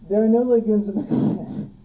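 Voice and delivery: loud, hammed-up declaration breaking into laughter; playfully overdramatic, laughing at the end; mock dramatic. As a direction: proclaim it with mock drama, cracking up. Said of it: "maybe they're just dramatic".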